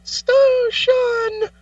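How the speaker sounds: very high, drawn-out squeaky voice; cartoon unicorn voice. Joining the Charlie the Unicorn bit in a very high cartoon voice. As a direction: stretch it out in a silly high cartoon voice. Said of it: "Charlie the Unicorn once said".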